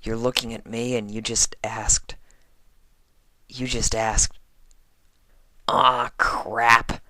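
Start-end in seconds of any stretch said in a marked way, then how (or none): none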